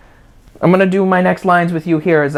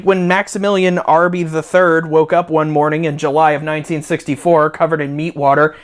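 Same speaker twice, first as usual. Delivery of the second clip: usual speaking voice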